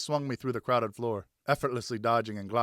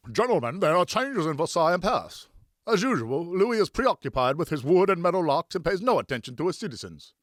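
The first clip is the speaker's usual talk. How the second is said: higher, louder voice of a worried investor; stout gentleman; 8 semitones higher, 5 dB louder than usual. Acted. Stout fellow lecturing gentlemen on politics, a pompous role voice. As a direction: hold forth like a fussy man of means. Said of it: "questioned a short, stout fellow".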